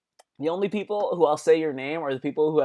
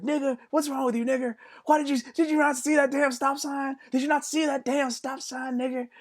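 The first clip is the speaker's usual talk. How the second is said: a much higher put-on voice, scolding; high mock yell; 12 semitones higher than usual. Friend scolding the buggy driver, high and scolding. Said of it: "this other guy's like".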